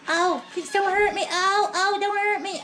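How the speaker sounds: high, whiny plea for mercy; pleading lobster voice. Pretend. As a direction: beg like a scared little lobster. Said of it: "This next one I think is gonna talk".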